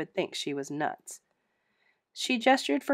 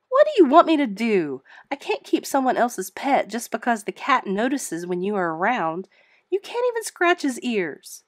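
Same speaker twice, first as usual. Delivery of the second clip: louder and higher, fed-up retort; exasperated outburst; 5 semitones higher, 7 dB louder than usual. Acted. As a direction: snap back in frustration. Exasperated arguing with the ghost.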